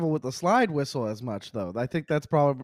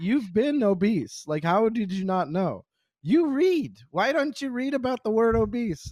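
high, incredulous mock scolding; doctor scolding; 8 semitones higher than usual. Doctor telling a friend he's obese, incredulous. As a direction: imitate the doctor, pitched up and disbelieving. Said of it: "so he's like".

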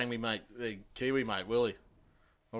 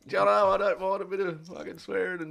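grovel to the whole family at once; mock apology; 9 semitones higher, 5 dB louder than usual. Apologising to the mate's family in the car, high and sheepish. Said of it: "And I'm like".

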